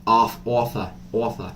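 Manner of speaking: a clumsy try at a New York accent on "offer", said like "awffa"; a hesitant amateur New York "offer". Shown as a demonstration. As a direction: fumble a mafia-style New York "offer", testing the vowel. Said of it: "I don't know how to do the New York accent".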